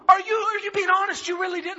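high-pitched, stammering disbelief; incredulous wife. Retold or imitated. Wife doubts her husband's answer, incredulous.

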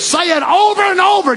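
high-pitched shouted exhortation; full-force preaching. Real, not acted. Preacher rouses congregation about singing their faith, shouting.

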